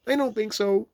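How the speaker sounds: very high, loud put-on voice; disguised Rabbit voice. A narrator's reading. Rabbit hiding who he is, disguised voice. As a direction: deny it in a false high voice. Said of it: "different sort of voice this time".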